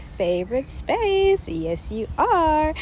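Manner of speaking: high slow fond coo. Real, not acted. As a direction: coo it like to a pet. Cooing fondly to her podcast.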